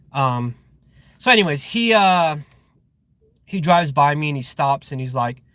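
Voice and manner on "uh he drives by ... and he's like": normal talking voice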